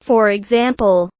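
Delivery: usual speaking voice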